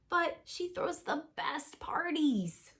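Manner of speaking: slow whine; whiny hungry Jack. Acted. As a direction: plead for food. Jack voiced in story.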